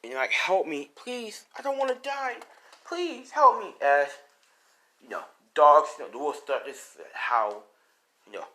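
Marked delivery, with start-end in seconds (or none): 0.3-3.7 high, begging not to die; desperate pleading. Acted. Deku about to fall, begging for help high and desperate. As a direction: plead with everything you have.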